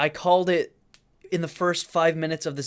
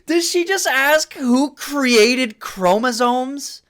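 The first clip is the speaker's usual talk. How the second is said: incredulous; 7 semitones higher, 4 dB louder, slower than usual. Real, not acted. Stunned by the guest's question.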